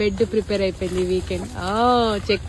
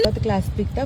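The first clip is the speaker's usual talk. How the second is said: normal talking voice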